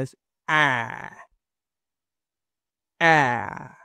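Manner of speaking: "A" with a very wide open mouth. Shown as a demonstration. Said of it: "súper abierta". ("A" súper abierta)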